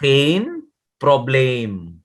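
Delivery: "Geen probleem" very slow, geen with a long A vowel; slow Dutch demo. Shown as a demonstration. Teacher modelling Dutch phrase to student, very slow demo. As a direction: draw out "Geen probleem", throaty G and ee like A.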